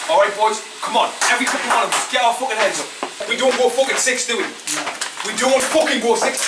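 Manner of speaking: put-on rally the boys, punching every line; halftime pep speech. Halftime team talk, rising intensity.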